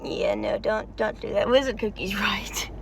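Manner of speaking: soft whisper with a little laugh; whispered aside. Real, not acted. Player agreeing with Wizard Cookie, whispering and laughing softly. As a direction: lean in and whisper it with a chuckle.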